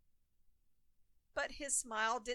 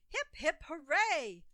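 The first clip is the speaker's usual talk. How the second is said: cheer; 5 semitones higher, 7 dB louder than usual. A narrator's reading. Crowd cheers Ollie.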